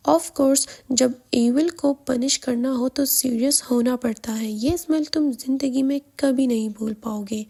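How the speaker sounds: plain reading voice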